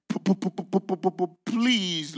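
halting stutter on the p; stammering Moses. Acted. Moses pleading with the Lord, stuttering. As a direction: catch on every p before please.

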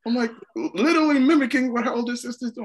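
amazed parent telling it high; excited disbelief. Real, not acted. Excited retelling, high and loud.

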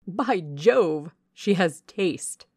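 loud, slowed, delighted By Jove; amused exclamation. Acted. Henry Lynn exclaims with delight, loud and slow. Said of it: "exclaimed Henry Lynn".

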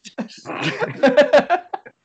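high rising laughter; laughing high and rising. Real, not acted. Group laughing at joke.

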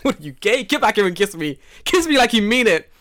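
loud, high mock dare; mocking taunt. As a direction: taunt him to come back.

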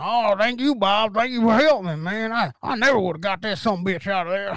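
mock country Southern neighbor; Southern twang. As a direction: gush thanks in a put-on Southern twang. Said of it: "you got a little twang".

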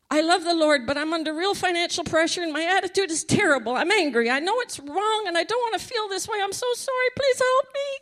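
high, fast, pleading confession; desperate plea. Retold or imitated. Confessing to the prayer line, fast high pleading. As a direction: pour it out, begging for help.

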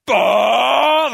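put-on loud, high angry cry of a toddler; child's frustrated cry. Toddler fighting over a toy, loud frustrated cry. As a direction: bark it out like a furious little kid.

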